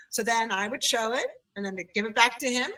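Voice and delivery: normal talking voice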